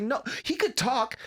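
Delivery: high-pitched, insistent complaint; exasperated arguing. Retold or imitated. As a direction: argue back, fed up with dad. Son argues back, exasperated.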